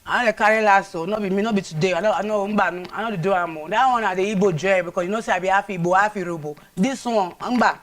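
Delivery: loud, animated Nigerian Pidgin; Warri Pidgin. Acted. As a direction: refuse him loudly in Warri Pidgin. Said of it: "In your local dialect, Warri language, the Pidgin".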